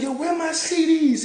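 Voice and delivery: loud, high mock demand; high-pitched imitation. Homeboy confronting Wayne about CDs, high-pitched. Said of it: "high pitch".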